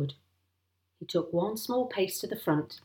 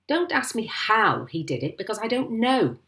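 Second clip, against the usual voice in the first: higher-pitched exasperated retort; indignant, raised voice; angry shout; 5 semitones higher, 4 dB louder than usual. A narrator's reading. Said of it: "shouted Mister Hazel".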